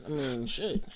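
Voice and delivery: ordinary talk, nothing marked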